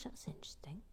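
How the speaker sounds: usual speaking voice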